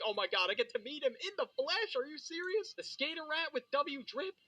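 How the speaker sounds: put-on high, starstruck kid; freaking out. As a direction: gush like meeting a hero. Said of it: "I'd start freaking out".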